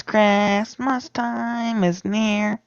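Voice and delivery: high, slow sung chipmunk voice. Shown as a demonstration. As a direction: sing it like a chipmunk. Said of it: "in Chipmunk voice".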